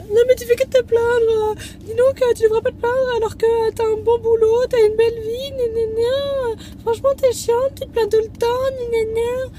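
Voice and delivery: mocking whiny voice. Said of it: "Comment on va me dire".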